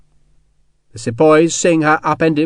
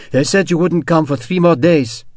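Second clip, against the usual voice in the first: a lightly voiced French woman, bitter; clipped French vowels, resentful; bitter French accent; 4 semitones higher than usual. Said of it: "she said bitterly in a strong accent".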